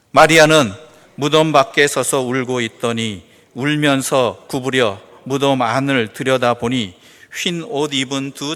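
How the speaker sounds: slow, low, solemn Bible reading; scripture reading. Acted. Pastor reading the scripture passage to the congregation, slow and solemn. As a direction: read the verses slowly and with reverence. Reads scripture verses aloud.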